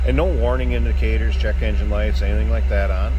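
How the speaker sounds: loud, projecting over the running engine and stereo. Real, not acted. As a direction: push your voice over the motor.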